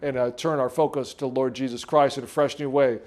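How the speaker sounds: soft, low prayer voice. Acted. Pastor leading the church into prayer, hushed and low. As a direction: ease the room into prayer, softly.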